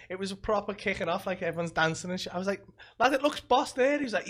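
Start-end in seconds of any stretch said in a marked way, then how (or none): 3.0-4.0 loud, much higher "Lad, it looks boss there"; retold eager shout at a party; excited shout. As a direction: call over the noise, thrilled.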